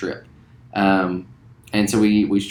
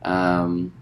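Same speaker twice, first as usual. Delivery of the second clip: normal talking voice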